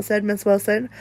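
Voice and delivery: ordinary delivery, nothing marked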